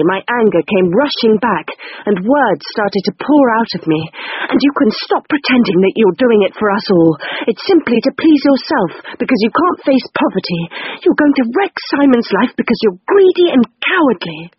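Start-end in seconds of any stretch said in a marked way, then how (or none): none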